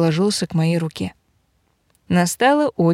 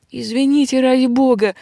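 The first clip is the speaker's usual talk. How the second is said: pleading; 6 semitones higher than usual. Acted. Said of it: "взмолился он".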